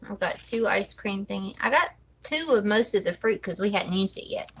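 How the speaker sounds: ordinary delivery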